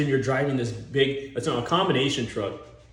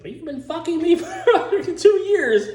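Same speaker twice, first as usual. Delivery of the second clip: much higher, louder, laughing through it; outraged girlfriend; 6 dB louder than usual. Retold or imitated. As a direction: squeal it like a wronged girlfriend, cracking up. Girlfriend's outraged reply acted.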